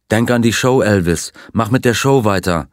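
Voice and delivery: usual speaking voice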